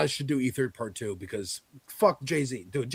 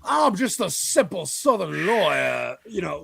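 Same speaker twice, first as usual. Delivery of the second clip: slow, louder, higher Southern lawyer drawl; hammed-up Southern senator; 4 semitones higher, 5 dB louder than usual. Mocking a senator who hams up his folksy Southern talk, slow Southern drawl. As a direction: ham up the folksy Southern accent like Foghorn Leghorn. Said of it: "He talks like Foghorn Leghorn".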